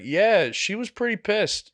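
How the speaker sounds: high, slow dry sarcasm; drawn-out sarcastic yeah. Retold or imitated. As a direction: stretch the yeah, deadpan. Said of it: "I was like".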